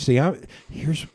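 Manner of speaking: ordinary talk, nothing marked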